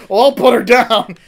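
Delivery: loud sinister reply; menacing pun. Retold or imitated. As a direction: snap back with the dark pun. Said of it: "menacing reply".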